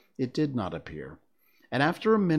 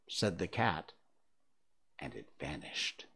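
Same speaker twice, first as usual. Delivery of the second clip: ordinary delivery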